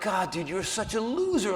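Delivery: berating yourself quietly after embarrassing yourself; muttering to self. Retold or imitated. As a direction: scold yourself quietly so no one hears. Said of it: "I heard what I said".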